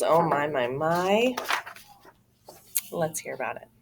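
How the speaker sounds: ordinary delivery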